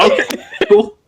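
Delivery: laughing through the words. Real, not acted. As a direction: giggle while answering. Laughing reply.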